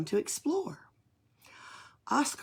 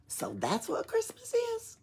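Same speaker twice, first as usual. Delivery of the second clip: much higher, softer wondering voice; amazed question; 4 dB quieter than usual. Acted. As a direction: ask in high-pitched astonishment. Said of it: "said Oscar".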